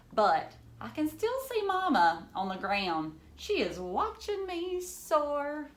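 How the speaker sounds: lively storybook reading for a class; reading to children. Acted. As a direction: read it like a picture book to kids. Teacher reads picture book aloud.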